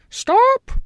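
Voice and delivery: very high, loud stop; very high, loud hen cry; hen's cry. A narrator's reading. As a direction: cry out to halt him. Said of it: "the hen suddenly cried".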